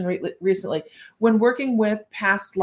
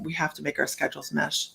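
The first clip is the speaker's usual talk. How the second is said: normal talking voice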